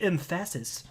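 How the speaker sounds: emphasis with the stress moved to the second syllable, em-FASS-is; joke mispronunciation. Shown as a demonstration. Host explaining his reading method, emphasis jokingly said em-FASS-is. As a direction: say emphasis playfully wrong, as em-FASS-is. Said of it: "which is the right way to say".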